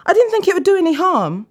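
high, loud panicked excuse; pleading. A narrator's reading. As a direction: beg to be forgiven. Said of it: "Dan cried out".

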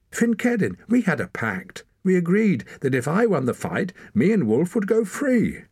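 high, insistent protest; urgent pleading. A narrator's reading. Boy reminds leader of their pact, pleading urgently. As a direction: appeal urgently, pitch jumping high.

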